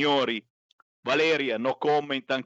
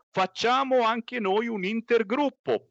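mock Meloni; 10 semitones higher than usual. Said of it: "La Meloni ha detto".

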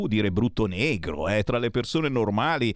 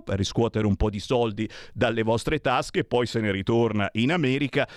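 ordinary delivery

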